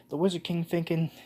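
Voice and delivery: ordinary talk, nothing marked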